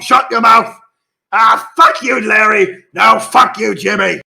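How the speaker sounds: high, loud squabbling imp voices; bickering imps. Acted. The GM plays imps bickering about the swamp, high and loud. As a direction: snipe at each other like petty imps.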